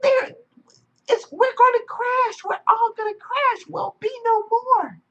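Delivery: high, loud, frantic alarm; panicking little wave. Acted. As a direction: sound the alarm before the crash. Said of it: "He was like".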